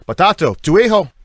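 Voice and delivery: loud, high call; excited announcement. Retold or imitated. Paul calling to the father, loud and excited.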